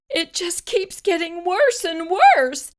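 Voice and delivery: high, loud desperate mother; frantic, rising cry of despair; anguished cry. A narrator's reading. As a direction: wail it in panic. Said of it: "cried Missus Cream".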